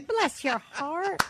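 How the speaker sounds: slow, high mock Southern drawl; imitated Southern accent. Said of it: "That's the famous one".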